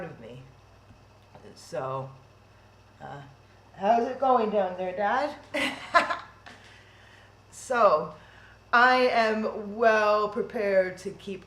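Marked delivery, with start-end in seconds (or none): 5.4-6.2 breathy high laugh. Real, not acted. Joke asking dead dad.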